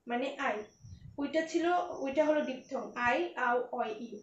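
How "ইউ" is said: ordinary talk, nothing marked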